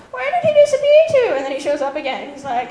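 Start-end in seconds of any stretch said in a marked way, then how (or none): 0.1-1.3 much higher put-on girl's voice; mock bewildered girl. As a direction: wonder aloud like a puzzled manga girl. Girl wonders where boy went.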